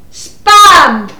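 SPAM shouted loud; one loud, emphatic word; exasperated shout. Acted. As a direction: shout Spam at someone hard of hearing. Second attempt after being misheard.

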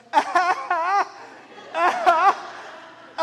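high, loud imitated baby whine; mock toddler whimper. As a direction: play the little one fake-crying along. Toddler copying the crying vibe.

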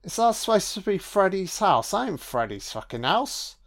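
ordinary talk, nothing marked

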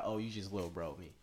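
low and soft, half under the breath; brushing him off quietly; muttered aside. Retold or imitated. A quiet brush-off to the guy who little-broed him. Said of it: "I was like".

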